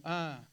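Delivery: "ah" alargada en un arco de tono; sube y baja. Shown as a demonstration. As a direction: estira "ah", sube y luego baja. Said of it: "digan conmigo". ("ah" sostenida para que repita la clase, subiendo y bajando)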